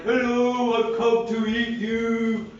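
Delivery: high put-on whale greeting; a cheery monster announcing dinner; a hammed-up friendly whale. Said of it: "The whale's like".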